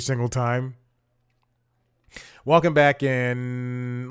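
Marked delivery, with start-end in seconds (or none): 2.4-4.1 drawn-out, slow welcome back in. Shown as a demonstration. Host restarting the segment, slow drawn-out welcome. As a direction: stretch the greeting out slowly. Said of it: "Let me reset".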